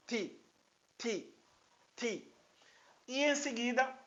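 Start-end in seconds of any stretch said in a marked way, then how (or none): 0.1-2.3 "ti" as a brief, softer syllable; short ti. Shown as a demonstration. Teacher repeats the short syllable for learners, brief "ti". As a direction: keep each "ti" short and separate. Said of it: "uma sílaba breve".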